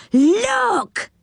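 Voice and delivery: high, long-drawn cry of amazement; high-pitched astonished boy; astonished shout. A narrator's reading. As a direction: call it out amazed, voice shooting up. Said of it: "gave a shout of astonishment".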